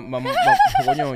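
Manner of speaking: breaking into high laughter mid-word; laughing. Real, not acted. Trying to speak seriously, cracking up laughing. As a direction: stammer through a real fit of laughter.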